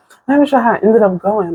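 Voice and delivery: ordinary delivery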